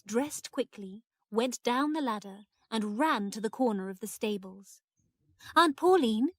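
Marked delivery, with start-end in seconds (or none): 5.6-6.3 high, loud call; raised, carrying hail. A narrator's reading. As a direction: call up to someone out of sight. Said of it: "she called".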